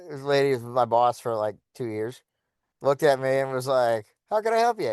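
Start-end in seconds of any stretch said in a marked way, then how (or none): 4.3-4.9 bright, high, polite shopkeeper tone; sweet helpful clerk greeting; customer service voice. Retold or imitated. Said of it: "in the nice customer service voice".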